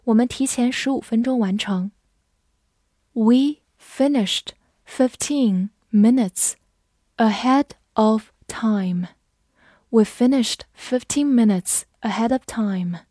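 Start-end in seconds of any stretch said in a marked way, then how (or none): 3.1-9.1 word by word, slow, with clear pauses; slow read-along. Shown as a demonstration. Teacher modelling the finishing-early sentence, slowly. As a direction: pace it slowly for learners to repeat.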